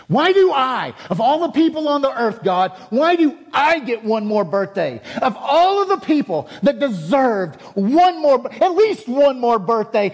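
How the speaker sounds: loud, high, pleading protest; impassioned retold yelling. Preacher re-enacting his outburst to God, high anguished yelling. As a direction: re-enact a tantrum at God, each question pitched high and loud. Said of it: "And I was yelling in tears".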